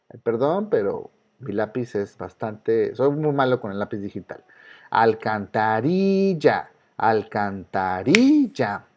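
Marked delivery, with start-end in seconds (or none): 4.9-8.9 al-can-ta-RIII-lla, very slow with RI held long; stress on RI. Shown as a demonstration. Teacher asks which syllable of alcantarilla is strongest, slow stress on RI. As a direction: stretch "alcantarilla" syllable by syllable, rising onto RI. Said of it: "¿Cuál es la que suena más fuerte?".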